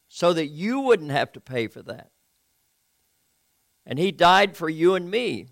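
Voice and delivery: ordinary talk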